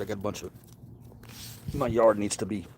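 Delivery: ordinary talk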